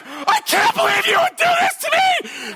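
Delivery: very high, loud scream of betrayal; acting out mom's screaming fit; enraged mother screaming. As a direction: shriek it at your kid in fury. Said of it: "he hears the screaming".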